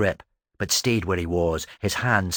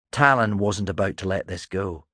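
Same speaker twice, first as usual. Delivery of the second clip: plain reading voice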